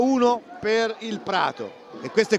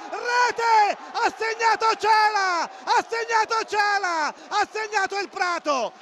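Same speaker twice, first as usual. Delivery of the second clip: screaming goal call, then stretched slow; breathless Italian goal commentary; 8 semitones higher, slower than usual. Real, not acted. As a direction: erupt high and hold every vowel. Goal scored, commentator erupts.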